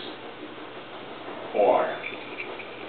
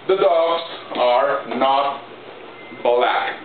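slow dictation; much slower than usual. Shown as a demonstration. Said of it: "dictating English to students".

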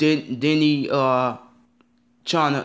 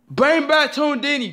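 much higher, slow drawn-out plea; calling out; 11 semitones higher, slower than usual. Real, not acted. Fan calling on Disney to bring the channel back, drawn-out high call.

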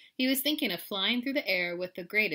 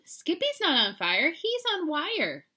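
lightly voiced little girl, higher; little sister; 9 semitones higher, 5 dB louder than usual. A narrator's reading. Sister corrects mama about kitty, higher voice. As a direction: point it out in a raised child's voice.

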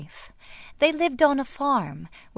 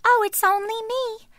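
very high little goat voice; tiny goat voice; 13 semitones higher than usual. A narrator's reading. Youngest goat answering the troll, tiny high voice. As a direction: squeak it like the tiniest goat. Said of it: "said the tiniest billy goat Gruff".

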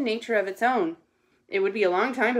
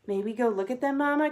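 lightly voiced little sister, rising higher; mildly excited cub; pleading Sister cub; 4 semitones higher than usual. A narrator's reading. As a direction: ask Mama with a touch of eager pleading. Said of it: "cried Sister".

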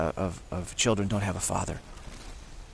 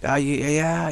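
higher, soothing mock mom; higher lightly voiced nice mom; nice cop mom; 5 semitones higher, slower than usual. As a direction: play the sweet-talking mom. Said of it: "She plays the nice cop".

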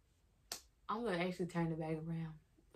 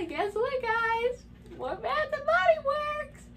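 much higher and louder, bursting with glee; excited reveal; 5 dB louder, slower than usual. Real, not acted. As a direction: announce the treat like a surprise. Unveiling gift to viewers.

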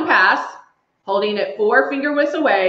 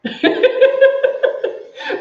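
1 real laugh. Real, not acted. Laughing at a viewer's comment.

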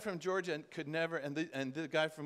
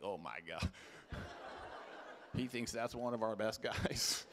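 laughing disbelief; 7 semitones lower, 6 dB quieter than usual. Retold or imitated.

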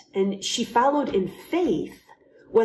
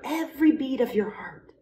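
usual speaking voice

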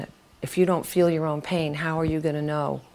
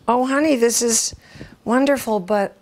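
higher, gently sweet mom voice; puzzled mother; 7 semitones higher than usual. Retold or imitated. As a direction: praise the child, then turn puzzled. Mother's puzzled reply to card.

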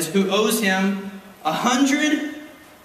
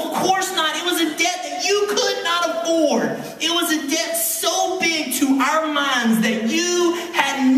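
raised, building preacher voice; preaching; 10 semitones higher than usual. Real, not acted. Pastor pressing the church on forgiveness, fervent preaching. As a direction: preach it to them with rising fire.

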